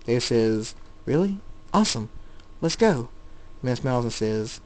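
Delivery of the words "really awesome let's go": eager, higher-pitched delight; excited. A narrator's reading. Young David offered training by the man, excited. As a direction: jump at the offer with a lifted voice. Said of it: "You are excited".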